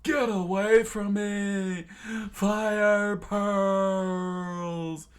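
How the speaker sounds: high, loud, drawn-out attack cry; slow high battle yell; Pearl screaming. A narrator's reading. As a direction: scream it, stretching the last word long. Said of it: "Pearl immediately screamed out".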